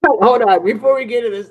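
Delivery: loud interruption. Real, not acted. Interrupting the guest loudly.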